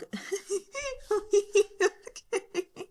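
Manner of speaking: high, helpless giggling; real laughter. Real, not acted. Reader cracks up over the maggot corpse line, giggling. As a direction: break up laughing.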